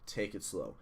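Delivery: usual speaking voice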